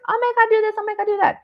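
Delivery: very high, gushing busybody voice; mock advice-givers. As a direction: imitate pushy advisers in a squeal. Mimics everybody's gushing parenting advice.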